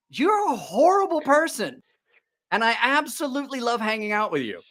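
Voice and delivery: half-laughing fake outrage; playful disgust at his buddy; mock scolding. Mock scolding friend, affectionate.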